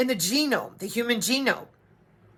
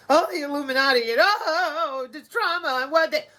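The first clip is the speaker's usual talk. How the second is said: mock whining; 5 semitones higher than usual. Mocks people whining about conspiracies.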